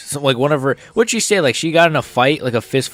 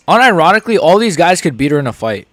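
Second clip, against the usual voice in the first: louder, higher burst; emphatic outburst; 5 semitones higher, 6 dB louder than usual. Real, not acted. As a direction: blurt it out loudly. Bursts out with an opinion.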